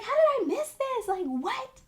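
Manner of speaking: very high, loud, incredulous squeal; shocked disbelief. Retold or imitated. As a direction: replay the jolt of finding the missed email. Retelling her shock at the email.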